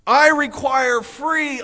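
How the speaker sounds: ordinary talk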